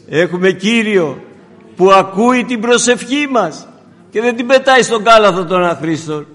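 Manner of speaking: loud, ringing proclamation; full-force preaching. Acted. Preacher proclaims to the congregation, loud and fervent. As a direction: thunder it to the church.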